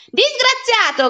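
high, loud outraged cry; acted enraged yell; furious shout. A narrator's reading. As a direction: hurl the insult at the boy. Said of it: "gridò il signor Clodoveo".

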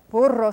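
"Burro" with a rolled R; Spanish "Burro" with a tongue trill. Shown as a demonstration. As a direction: roll the R in "Burro", Spanish style. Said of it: "that's got that trilled R".

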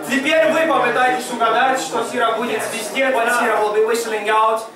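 usual speaking voice

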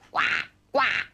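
imitated duck quacks. Presenter making noises for guessing game, duck quacking. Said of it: "This one walks in a very funny way".

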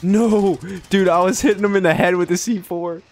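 loud, high gamer yell; shrieking at the C four play; excited shout. Real, not acted. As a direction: yell it in delighted disbelief. Hitting enemy with C4.

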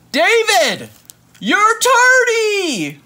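high, loud, slow scolding; teacher's shout. Acted. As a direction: drag out tardy like a cross teacher. Teacher scolding in read book.

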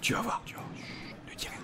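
hushed, teasing whisper; playful whisper. Pretend. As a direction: whisper it like a secret surprise. Teasing viewer before a move.

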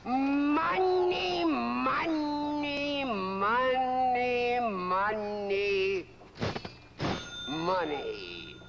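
long stretched words, starting high and stepping down; drawn-out musing. Acted. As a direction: savour each word, stretching it out slowly. Theatrical musing on money.

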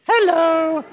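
high sing-song hello; a bright greeting pitched high; high hello for Mary. Shown as a demonstration. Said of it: "One, two, three".

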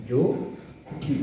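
যদি stretched out slowly, the first vowel like o, "jodi"; pronounced as spoken. Shown as a demonstration. Teacher models যদি for students, stretched out as "jodi". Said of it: "আমি বলতে হবে".

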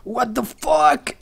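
slow, drawn-out bewildered exclamation; drawn-out what the. Real, not acted. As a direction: stretch it out, baffled. Confused at game.

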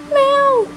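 high imitated cat meow; mock meow. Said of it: "I'm so mean".

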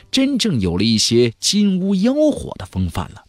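plain reading voice

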